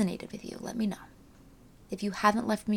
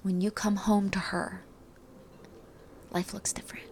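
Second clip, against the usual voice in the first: soft hushed spiritual voice; quiet and airy, almost a whisper; airy breathy mystical healer; 7 dB quieter than usual. Acted. Closing soul message.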